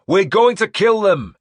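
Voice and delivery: high, loud war cry; battle shout. A narrator's reading. Leader promising slaughter to his men, battle shout. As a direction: fire up the men behind you. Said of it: "I shouted".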